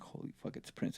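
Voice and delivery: very soft, under-the-breath amazement; hushed awe. Retold or imitated. Engineer privately reacting to a star's arrival, hushed awe. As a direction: keep the star-struck reaction almost voiceless.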